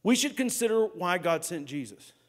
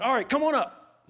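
loud call; 9 semitones higher, 6 dB louder than usual. Retold or imitated. Fake phone call to dad.

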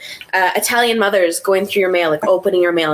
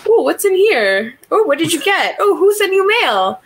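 nosy Italian mom; 9 semitones higher than usual. Retold or imitated. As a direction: coo like a mom snooping through your package. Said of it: "be like".

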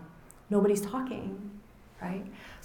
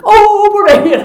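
very high, much louder gush; gushing comfort; 14 semitones higher, 19 dB louder than usual. Retold or imitated. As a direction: smother the baby with pity. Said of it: "she's like".

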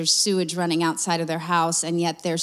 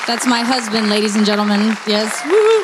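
louder, higher, ending in a big whoop; loud cheer; 3 semitones higher, 8 dB louder than usual. Real, not acted. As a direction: hype the crowd up for him. Wife cheers husband for crowd.

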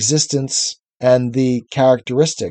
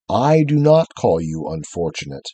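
large deep voice; 8 semitones lower than usual. A narrator's reading. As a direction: let the words come from deep down. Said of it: "said the large voice".

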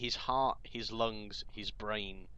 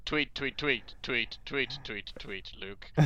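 Alec Guinness as a bird; 3 semitones higher, slower than usual. Retold or imitated. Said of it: "in a kind of Alec Guinness sort of voice".

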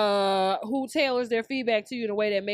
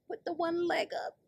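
ordinary talk

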